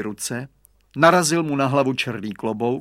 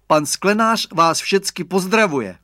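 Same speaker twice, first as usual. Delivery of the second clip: lightly voiced boy, higher and louder; boy's announcement; 6 semitones higher, 6 dB louder than usual. A narrator's reading. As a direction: pipe up with a message. Boy relays greeting to father. (klukovské hlášení)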